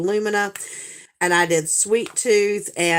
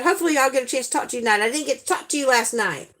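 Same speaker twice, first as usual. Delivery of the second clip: normal talking voice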